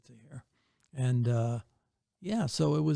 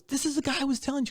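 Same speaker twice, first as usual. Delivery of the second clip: much higher, quick imitating voice; introducing him; 11 semitones higher, faster than usual. [name] introducing [name] to his wife, fast and much higher. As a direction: rattle it off fast and high. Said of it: "He goes".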